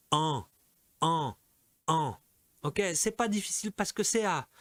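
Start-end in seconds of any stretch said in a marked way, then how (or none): 0.1-2.2 "en" repeated as an isolated French nasal vowel; demonstrated en sound. Shown as a demonstration. Teacher modelling the second column, "en" as a nasal vowel. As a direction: say each "en" separately, nasal with no n consonant. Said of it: "Deuxième colonne".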